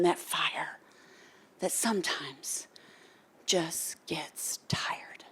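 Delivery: softening down to a whisper; fading whisper. Real, not acted. Describing a tired fire, fading to whisper. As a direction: let it die away.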